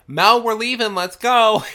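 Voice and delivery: high, loud call across the house; yelling. Retold or imitated. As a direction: shout it to the other room. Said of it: "she yells".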